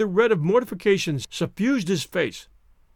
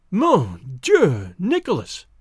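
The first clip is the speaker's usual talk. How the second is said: shocked cry; 4 dB louder, much slower than usual. A narrator's reading. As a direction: gasp out the name in shock. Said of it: "he cried".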